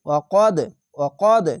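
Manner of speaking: কদ with an echoing bounce on the final দ; bouncing qalqala. Shown as a demonstration. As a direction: bounce the দ of কদ downward. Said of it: "কলকলা হবে, নিচের দিকে sound আসবে". (কদ শব্দে কলকলা দিয়ে)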